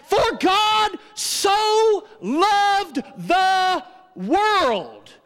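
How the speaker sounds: preaching shout. Acted. Preacher quotes scripture.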